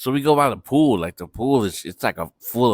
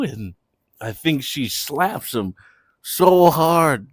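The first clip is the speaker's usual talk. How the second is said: usual speaking voice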